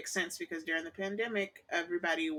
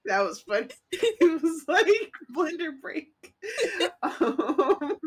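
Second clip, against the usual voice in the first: talking through real laughter; laughing; 12 semitones higher, 8 dB louder, slower than usual. Real, not acted. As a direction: laugh while you talk. Laughing at blender mishap.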